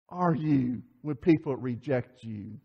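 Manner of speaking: ordinary talk, nothing marked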